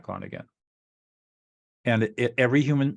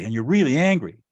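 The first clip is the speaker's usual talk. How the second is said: coming in underneath; 3 semitones higher, 6 dB louder than usual. Shown as a demonstration. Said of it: "I'll come in right underneath her".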